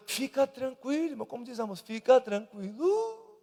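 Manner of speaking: a pastor soothing the flock; soft reassurance. Acted. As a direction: settle the anxious believer gently. Soft reassurance after preaching.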